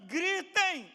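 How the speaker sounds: high, loud, stretched command; shouted exhortation. Acted. Preacher ordering the congregation to shout, full force. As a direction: belt out the order, holding it long.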